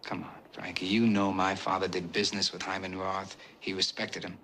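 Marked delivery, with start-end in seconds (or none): 0.0-0.9 soft, softer whispered appeal; calm him down under your breath; hushed whisper. Acted. The Don hushing the drunk old mobster, whispered. Soothing the angry old man.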